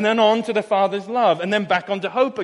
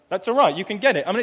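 normal talking voice

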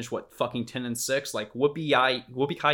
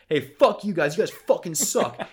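raised, higher mock heckle; heckling fan; 5 semitones higher, 4 dB louder than usual. Fan in the stands heckling the team, mock yell. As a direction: imitate an angry fan yelling abuse.

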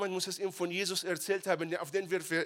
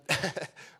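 short laugh. Real, not acted. Laughing at own joke.

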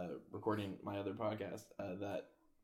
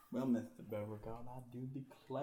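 put-on soft genteel Southern belle accent; 6 dB quieter than usual. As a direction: re-enact a Southern belle, fading away. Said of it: "The Southern Belle".

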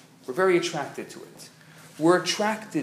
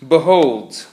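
loud, grand biblical reading; 12 dB louder than usual. Shown as a demonstration. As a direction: proclaim it like a prophet. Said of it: "very biblical, right?".

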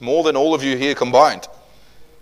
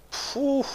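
high, soft whistling phew; pained exhale; long blown exhale; 8 dB quieter than usual. Acted. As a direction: breathe out slowly as if stung. Reacts to the mocking of Jesus.

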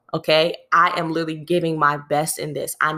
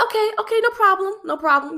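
much higher, bright chirpy reassurance; forced cheerful okay. Retold or imitated. To her boss, a high, brittle mock-cheerful okay. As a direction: sing out okay to hide the hurt. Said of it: "I said".

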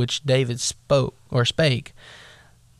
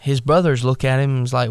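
normal talking voice

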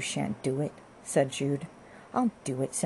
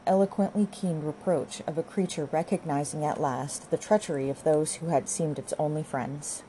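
usual speaking voice

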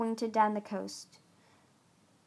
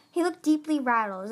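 ordinary delivery

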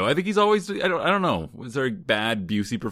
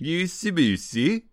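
slow, higher mock-Swedish lilt; 8 semitones higher, slower than usual. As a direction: put on a playful Swedish-sounding accent. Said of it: "Which sounds like Swedish".

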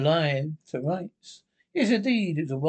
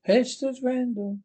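witch voice; 8 semitones higher than usual. Acted. Witch Hester mocking the judge, high eerie witch voice. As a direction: sneer at him like a ghostly witch.

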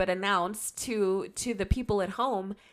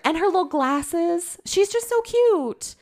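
gushing; 8 semitones higher, 4 dB louder, slower than usual. Real, not acted. Gushing over a beloved character.

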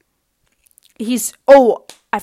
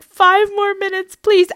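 mock-childish plea; kid begging mom; 9 semitones higher than usual. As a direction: beg like a kid who won't get out of bed. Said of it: "my mom walking into my bedroom".

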